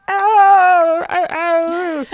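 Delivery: loud, high mock howls of pain; hammed-up crybaby wail; pathetic wailing. Suspect complaining during questioning, pathetic high wailing. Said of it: "really pathetic about it".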